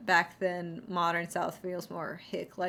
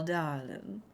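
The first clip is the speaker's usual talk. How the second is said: a hint of a Southern belle gushing; mock southern belle. Actors wanting to play it up, mock Southern belle accent. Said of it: "talk to you like a southern belle".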